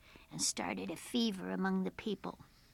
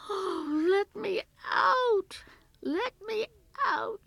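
very high, slow wail; frantic trapped girl crying out; desperate cry; 13 semitones higher, 11 dB louder, slower than usual. A narrator's reading. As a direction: gasp out a loud plea for air. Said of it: "she cried".

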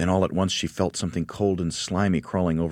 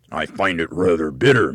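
put-on louder grumbling old man; grumpy old man; 7 dB louder than usual. Husband's voice in story.

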